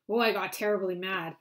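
usual speaking voice

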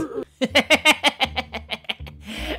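long, high put-on cackle; gleeful cackle. Player gloating over a prank on Grandma, high cackle. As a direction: cackle over a wicked plan.

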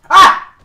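piercing high scream, very loud; battle scream. Real, not acted. Gamer screams to power up attacks, piercing battle scream. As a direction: let out a shrill attack scream. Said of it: "You have to scream".

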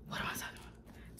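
a real, breathy aside under her breath; whispered aside. Real, not acted. Vlogger losing her train of thought mid-story, whispers to herself.

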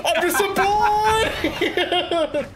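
high, slow hammed-up pleading; mock begging. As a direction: wail like a helpless boy. Mock begging while dying.

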